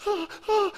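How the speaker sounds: high panting whimper; frightened kid gasps. Retold or imitated. Scared bait boy in the dark, high frightened gasps. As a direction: imitate a scared boy's quick high gasps. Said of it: "little Benny's like".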